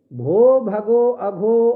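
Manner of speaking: slow, measured recitation of a Sanskrit rule; sutra recitation. Shown as a demonstration. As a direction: intone the rule slowly and evenly.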